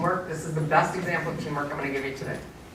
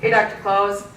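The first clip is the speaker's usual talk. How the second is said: higher, overlapping staff hellos in a row; chorus of greetings; 6 semitones higher, 3 dB louder than usual. Retold or imitated. Surgical staff greeting the surgeon one after another, cheerful. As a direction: toss off hellos like a room full of staff. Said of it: "everyone's like".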